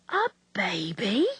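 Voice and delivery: put-on slow incredulous whisper rising on baby; a slow hushed gasp of a question, horrified; shocked whispered question. A narrator's reading. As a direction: hiss it back in disbelief. Nurse echoes in disbelief.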